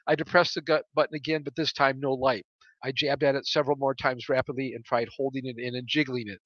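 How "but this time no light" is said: usual speaking voice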